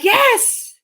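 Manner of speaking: high excited yes; mock cheer, high-pitched. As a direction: cheer it like a fan. Cheering at the commercial.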